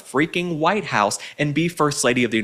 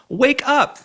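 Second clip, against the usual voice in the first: raised, sharp wake-up call; calling out Michelle Obama; exasperated call; 10 semitones higher than usual. Real, not acted. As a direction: snap her out of it. Yelling at Michelle.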